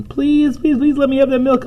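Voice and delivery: very high, rapid squealed plea; plaintive begging. A narrator's reading. Cat begging Missus Monroe for milk, high plaintive pleading. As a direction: beg fast in a tiny high voice.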